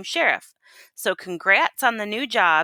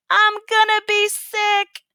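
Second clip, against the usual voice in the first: high, slow whiny wail; panicked wail; 13 semitones higher, 5 dB louder, slower than usual. Acted. Outlaw spinning in the tornado, wailing for mommy. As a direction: wail like a scared child.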